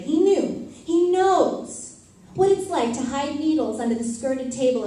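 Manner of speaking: rising emotional cry; passionate outburst. Acted. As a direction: let it burst out of you. Poem climax, passionate outburst.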